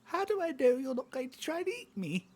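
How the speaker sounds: high, loud, squeaky critter voice; wary little creature. Acted. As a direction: challenge them as a tiny suspicious animal.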